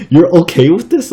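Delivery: high, loud incredulous question; mock disbelief. Questioning mom about tolerating moths, loud mock disbelief. Retold disbelief at mom's calm.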